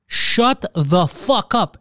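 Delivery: slow, drawn-out threat at the cat; angry at the cat. Retold or imitated. Man yelling at a hissing cat at night, slow and angry. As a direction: spit each word at the cat.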